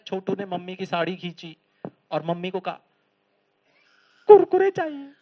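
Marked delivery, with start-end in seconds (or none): 4.3-5.1 put-on high, loud childish demand. Small boy tugs mother's sari for snacks. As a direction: tug and whine for snacks.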